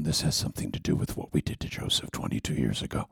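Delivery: low guilty whisper. Retold or imitated. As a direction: murmur to the others in dread. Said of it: "looking at each other going".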